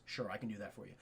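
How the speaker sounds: very low, quiet reply; understated deep-voiced agreement; imitated gamekeeper. Acting Muldoon's agreeing reply.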